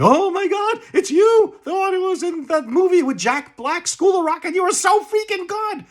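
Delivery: high, loud fanboy gushing; gushing starstruck fan. Retold or imitated. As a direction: squeal it like a starstruck fan meeting a star. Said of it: "and going".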